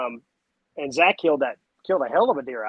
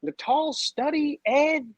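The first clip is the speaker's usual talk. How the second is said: starstruck; 10 semitones higher, slower than usual. Retold or imitated. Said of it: "I said".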